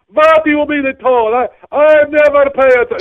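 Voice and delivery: high, loud, slow outrage; indignant passenger. Retold or imitated. Passenger balking at the toll to the driver, high and loud. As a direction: play her shocked at the toll. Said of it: "She goes".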